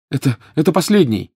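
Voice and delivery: high, breathless outburst; panting, strained cry; gasping exclamation. A narrator's reading. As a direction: exclaim while short of breath. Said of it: "задыхаясь, воскликнул Гарри".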